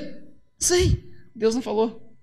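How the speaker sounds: a hint of a baffled patriarch; lightly voiced unsure Abraão. (Abraão perdido, voz mais aguda e arrastada)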